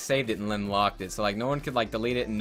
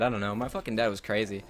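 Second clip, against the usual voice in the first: normal talking voice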